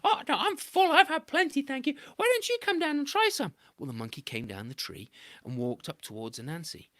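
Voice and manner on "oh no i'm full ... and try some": very high sweet wheedling; coaxing trickster. A narrator's reading. Anansi coaxing Monkey toward the honey tree, high and wheedling. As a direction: sell the honey hard.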